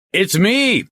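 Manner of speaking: high, loud yell down a phone. Acted. Police chief yelling into the mouthpiece on a bad line, yelling. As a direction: yell to get through a crackling line. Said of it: "he yelled into the mouthpiece".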